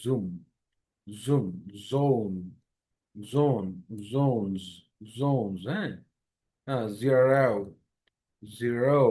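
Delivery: জুম with a buzzing z, not j; voiced z sound. Shown as a demonstration. Teacher drills the correct z in zoom. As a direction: start জুম with a hummed z. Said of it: "সেক্ষেত্রে বলতে হবে জুম".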